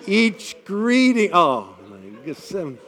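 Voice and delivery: ordinary talk, nothing marked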